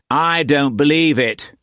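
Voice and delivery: high, scoffing disbelief. A narrator's reading. Roach rejecting the translated edict, scoffing. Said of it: "Roach scoffed".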